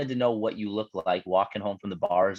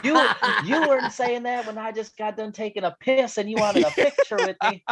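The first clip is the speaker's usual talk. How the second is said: high-pitched comic comeback; mock driver retort; 12 semitones higher than usual. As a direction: play an indignant celebrity in a high joke voice. Joking impression of the heckled driver.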